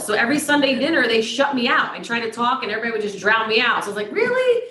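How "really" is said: high-pitched retold "can you believe this" reaction; indignant, high questioning yelp; incredulous. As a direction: jump up high in mock disbelief. Retold protest at being drowned out.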